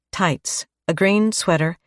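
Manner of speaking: usual speaking voice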